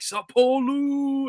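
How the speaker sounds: high, drawn-out mock holler without real volume; imitated street yell. Fans hailing his jersey from across the street, imitated yell. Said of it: "yelling at me across the street".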